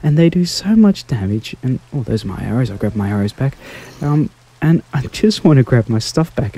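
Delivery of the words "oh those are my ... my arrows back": ordinary talk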